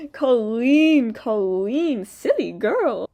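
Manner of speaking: condescending, put-on voice; mocking scold. Real, not acted. Mocking scold after laugh.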